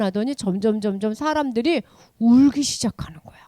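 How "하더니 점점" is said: normal talking voice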